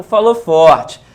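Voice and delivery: loud, punched demo; firm projected voice; speaking strong. Shown as a demonstration. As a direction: push it out loud to show strength.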